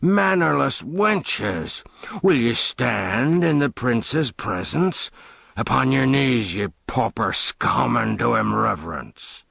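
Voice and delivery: coarse jeering father, slow and scornful; mocking father. Father jeers at his daughters to kneel, scornful. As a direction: lord it over the girls with a sneer.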